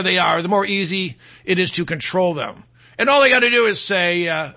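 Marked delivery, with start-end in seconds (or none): none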